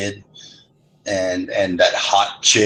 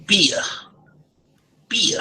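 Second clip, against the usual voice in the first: high Boston beer without the r; child's Southie accent; 11 semitones higher than usual. Retold or imitated. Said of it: "say beer".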